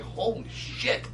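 slow, whispered disbelief, retold; hushed aside to oneself; muttering to himself. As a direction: say it under your breath, drawn out. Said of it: "I'm talking to myself".

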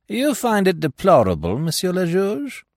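high-pitched, pointed query; challenging Giraud. A narrator's reading. Giraud cutting in on the magistrate, high challenging question.

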